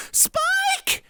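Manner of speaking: high desperate scream of a name. Acted. Twilight screaming for Spike, panicked. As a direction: scream out for a lost friend. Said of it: "Twilight screamed".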